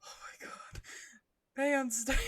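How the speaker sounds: plain reading voice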